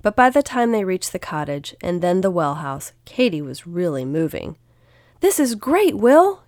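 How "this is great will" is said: high, loud, thrilled shout; excited yell. A narrator's reading. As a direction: yell it with delight over the rush. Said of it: "Katy yelled".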